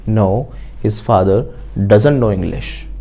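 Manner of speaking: very slow, word by word, for students to repeat; slow model sentence. Shown as a demonstration. Teacher modelling an answer for the class, very slowly. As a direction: spell each word out slowly like a dictation.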